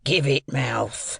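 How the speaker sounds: slow, drawn-out rough convict role; menacing convict. A narrator's reading. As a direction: draw the words out to threaten a child. Convict threatening the boy.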